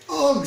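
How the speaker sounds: loud high ugh of disgust; loud disgusted innkeeper ugh; disgusted groan. A narrator's reading. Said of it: "said the innkeeper".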